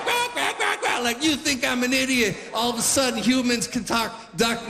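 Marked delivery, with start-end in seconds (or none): 0.0-1.0 very high, squawky quacks; comic duck call, pitched way up; retorting duck. Retold or imitated. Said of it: "and the other one's like". 1.2-2.4 high-pitched mock indignation; comic affronted retort, pitched up; offended duck. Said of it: "and the other one's like".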